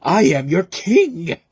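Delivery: loud, slow, wild-eyed proclamation; crazed king. Retold or imitated. Reviewer mocking Matthew Lillard's line, crazed and slow. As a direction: drag out each word like a madman. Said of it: "that crazed look in his eyes going".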